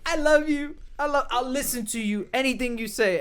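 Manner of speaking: high-pitched sloppy pleading; drunk wife imitation. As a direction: gush like a very drunk woman. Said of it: "And she's drunker, bro".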